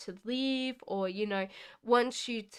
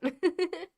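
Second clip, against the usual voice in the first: high little chuckle; short giggle; 8 semitones higher than usual. Real, not acted. Comparing British and Australian accents, short giggle. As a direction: giggle briefly after your remark.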